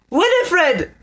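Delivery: high desperate shout. A narrator's reading. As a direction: yell her name in panic. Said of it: "Lord Pauldie Rhine shouted".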